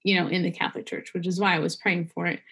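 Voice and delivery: ordinary talk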